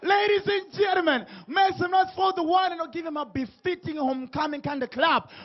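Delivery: high announcer shout, name stretched long; hype announcer. Real, not acted. Host bringing the DJ on stage, hype announcer. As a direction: whip the crowd into noise.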